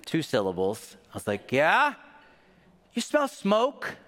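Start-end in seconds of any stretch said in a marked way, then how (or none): 1.5-2.0 called reply. Retold or imitated. Boy answering mom's call. 2.9-4.0 much higher, slow, questioning mother; a mom sniffing out trouble; suspicious Southern mom. Retold or imitated. Mom asks her son about smoke, higher, suspicious.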